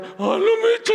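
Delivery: very high, loud shriek of alarm; startled outcry. Retold or imitated. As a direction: imitate a spooked aunt crying out. Aunt's startled cry in retold story.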